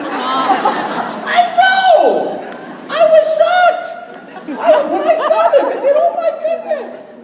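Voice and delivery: high, loud, laughing mock astonishment; mock shock. Speaker to the crowd, laughing fake astonishment. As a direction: act floored by the news, laughing.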